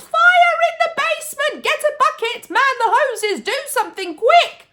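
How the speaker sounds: very high, loud frantic orders; grandma yelling for buckets and hoses; panicked yelling. A narrator's reading. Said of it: "the old woman yelled".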